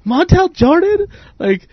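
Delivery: high-pitched scoffing disbelief; incredulous mockery. Retells laughing in challenger's face.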